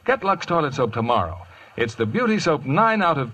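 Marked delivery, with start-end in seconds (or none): none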